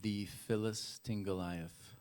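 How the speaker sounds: slow, separated reading of "The Philistine Goliath"; "The Philistine Goliath" drawn out slowly; slow sounding-out. Shown as a demonstration. Reads puzzle words aloud slowly.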